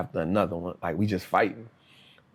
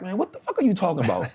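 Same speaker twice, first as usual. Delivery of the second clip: fast, higher, irritated comeback; frustrated retort; 8 semitones higher, faster than usual. Retold or imitated. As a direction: snap back at the cellmate in disbelief. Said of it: "I'm like, frustrated".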